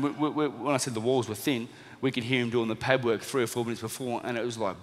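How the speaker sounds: ordinary talk, nothing marked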